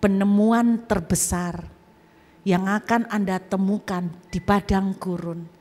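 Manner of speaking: slow, deliberate preaching cadence; sermon preaching. Acted. The preacher drawing the lesson for the congregation, slow preaching. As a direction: preach it slowly, weighing each phrase.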